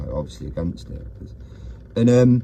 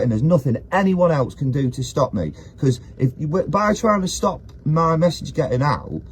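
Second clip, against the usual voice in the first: raised, heated rant pitched high; 8 semitones higher, 4 dB louder than usual. Real, not acted. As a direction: push your point hard and loud, half pleading. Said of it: "I was ranting about something".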